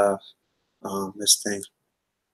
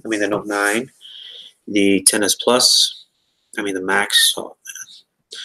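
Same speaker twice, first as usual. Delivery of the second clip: ordinary talk, nothing marked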